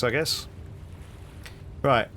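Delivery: usual speaking voice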